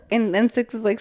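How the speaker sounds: normal talking voice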